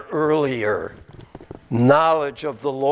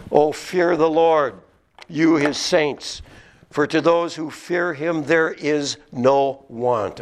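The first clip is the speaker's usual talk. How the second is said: slow, raised reading voice; solemn raised Psalm reading; scripture reading; 6 semitones higher, slower than usual. Shown as a demonstration. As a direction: read the Psalm out with weight.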